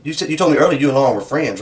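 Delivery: ordinary talk, nothing marked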